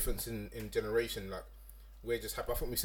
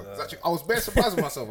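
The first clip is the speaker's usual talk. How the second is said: normal talking voice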